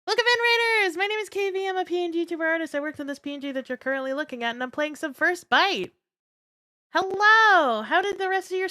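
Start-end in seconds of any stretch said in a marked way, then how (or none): 0.1-1.0 quick excited greeting. Real, not acted. Repeated welcome, quicker. 1.0-5.9 high reading voice. Acted. Reads raider intro aloud.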